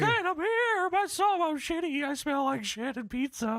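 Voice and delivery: put-on high, strained old-man squeak; hammed-up high Paul Bearer whine; squeaky Paul Bearer voice. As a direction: squeal it like a pathetic old ghoul. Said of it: "Paul Bearer says".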